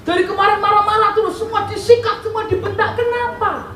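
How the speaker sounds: high-pitched, piled-up retold accusations; mock exasperation, held high in pitch; exasperated confronting. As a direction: replay fed-up scolding, ending on a demand. Retold confronting a grumpy friend.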